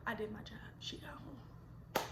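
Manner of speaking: hushed, relieved whisper. Real, not acted. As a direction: breathe it out like a secret. Relief aside after dropping friend.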